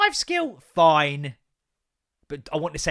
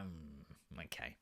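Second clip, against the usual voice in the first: wary murmur; 12 semitones lower, 20 dB quieter, much slower than usual. Retold or imitated. Reacting to the push on the door.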